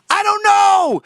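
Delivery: high, loud exasperated scream; shrieking I don't know. Retold or imitated. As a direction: scream it like you've given up. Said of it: "And I screamed".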